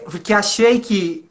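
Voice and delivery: ordinary talk, nothing marked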